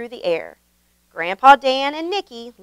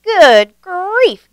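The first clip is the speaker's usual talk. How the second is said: higher, louder alarmed exclamation; startled gasp; 9 semitones higher, 7 dB louder than usual. A narrator's reading. Grandpa Dan startled on the runaway toboggan, higher and louder. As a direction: caught off guard as the sled lurches. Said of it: "gasped Grandpa Dan".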